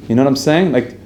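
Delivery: normal talking voice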